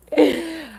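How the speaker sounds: real giggle; short high laugh. Real, not acted. Cracking herself up.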